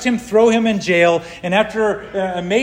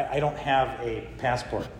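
much softer, halting I don't have a passport; sheepish apology; 7 semitones lower, 11 dB quieter than usual. Retold or imitated. Telling the border officer he has no passport, sheepish and soft. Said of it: "Well, officer, I'm sorry".

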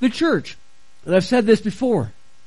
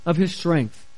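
low, measured Bible reading; scripture reading; 6 semitones lower, 4 dB quieter than usual. A narrator's reading. Pastor reads Paul's prayer to the congregation, low scripture reading. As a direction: read the passage aloud in a lower voice.

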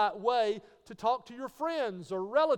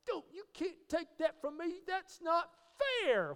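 put-on protesting toddler; 9 semitones higher than usual. Preacher mimicking a toddler's sense of fairness, protesting child voice. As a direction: play a small child objecting to unfairness. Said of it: "Every little child, from the time they're a toddler".

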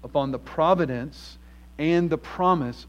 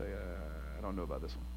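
a much lower, much softer doubtful aside; hesitant mumble; 10 semitones lower, 15 dB quieter than usual. Pretend. A disciple mumbling his doubts to Jesus, hesitant and much lower. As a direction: mumble it like you're backing out of the deal. Disciples' imagined reluctant reply.

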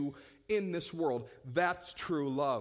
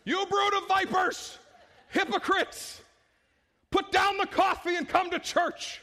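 high, loud hammed-up street preacher yelling; mock street-corner scream; 13 semitones higher, 6 dB louder, slower than usual. Pastor joking about screaming at churchgoers on the corner, hammed-up shout. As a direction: bellow it like a wild-eyed street preacher. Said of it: "and begin to scream".

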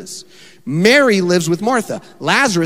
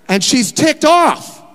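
high, loud, slow building indignation; ticked off; 11 semitones higher, 5 dB louder, slower than usual. Acted. Preacher acting out Martha's irritation, loud and high. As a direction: let the anger boil up as you say it.

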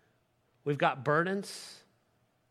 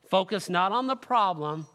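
ordinary talk

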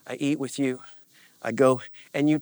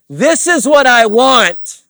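high, drawn-out shout; loud, high-pitched demand of the flesh; insistent demand; 12 semitones higher, 14 dB louder, slower than usual. Acted. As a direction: shout it as a slow, stubborn demand.

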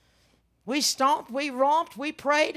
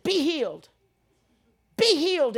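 high, punched command, building louder; forceful healing command; 6 semitones higher, 6 dB louder than usual. Retold or imitated. Preacher praying over a sick friend, insistent command. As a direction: drive each word in as an order.

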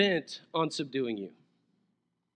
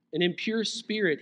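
ordinary talk

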